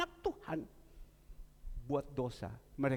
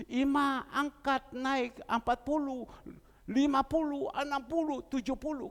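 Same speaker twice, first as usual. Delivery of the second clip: higher, louder, building triumph; fervent preaching; 9 semitones higher, 4 dB louder than usual. Acted. Preacher proclaiming to the congregation how God raised Ima. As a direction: proclaim the miracle with mounting joy. Preaching the miracle at full force.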